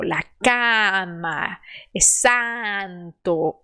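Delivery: very slow "La cama", "santo" with open a. Shown as a demonstration. Teacher models open Spanish a slowly. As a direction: open wide on each a. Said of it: "abra a boca".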